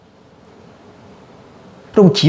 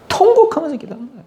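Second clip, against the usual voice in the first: high, cracking wail; mock wail; 12 semitones higher, faster than usual. Shown as a demonstration. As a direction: let the voice break into a cry. (울먹이며 높아진 목소리)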